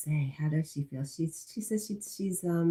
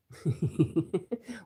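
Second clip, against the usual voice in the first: laugh. Real, not acted. Streamer teasing viewer who wants rain, giggling laugh. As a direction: giggle in a quick run.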